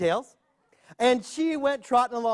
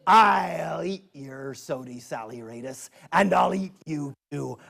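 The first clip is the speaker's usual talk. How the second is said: lightly growled bear threat, slowed; a hint of a low bear growl; 7 semitones lower, slower than usual. Bear threatens the boy with a mild growl. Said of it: "And the bear said".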